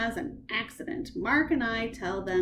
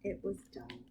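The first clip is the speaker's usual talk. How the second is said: hushed and low; 9 semitones lower, 12 dB quieter, faster than usual. A narrator's reading. Dramatic reveal.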